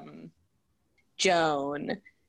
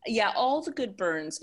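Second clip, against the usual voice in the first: ordinary delivery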